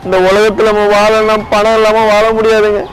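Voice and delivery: high, loud tearful outburst; crying hard while talking. Real, not acted. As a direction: wail it out in despair. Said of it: "first please அழாதீங்க".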